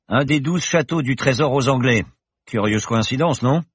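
the confident pilot professor, merry; cheerful professor. Acted. Professor reassures Paloma in the plane, jaunty and loud. Bergton hints slyly.

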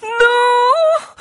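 long, very high, loud cry; drawn-out desperate shriek; lung-tearing scream. A narrator's reading. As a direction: scream it out at full lung power. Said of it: "cria plus fort encore Alix, à s'en déchirer les poumons".

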